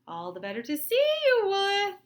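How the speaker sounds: high, loud wolf in disguise. Acted. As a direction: answer slyly as the wolf. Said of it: "said the wolf".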